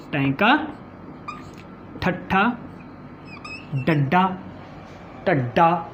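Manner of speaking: low, deliberate drill of ਟੈਂਕਾ, ਠੱਠਾ, ਡੱਡਾ, ਢੱਡਾ; retroflex sounds demo. Shown as a demonstration. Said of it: "ਦੇਖੋ ਜੀ ਉਚਾਰਨ ਕਰਦੇ ਹਾਂ".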